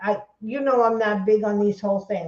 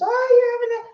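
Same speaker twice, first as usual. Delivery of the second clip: high, gushing mimicry; mocking imitation; 10 semitones higher than usual. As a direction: mimic a stranger's exclamation, pitched way up. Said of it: "That's all they said to me".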